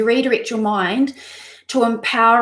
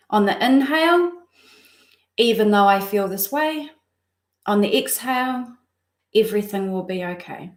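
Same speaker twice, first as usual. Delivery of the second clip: guided breathing mantra. Acted. Coach guiding live viewers through a breathing mantra, calm and soothing. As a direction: lead their breath in and out gently.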